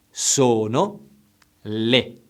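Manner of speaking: slow and carefully enunciated; slow, over enunciating. Shown as a demonstration. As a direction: say each word slowly and clearly. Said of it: "I will say it slowly now".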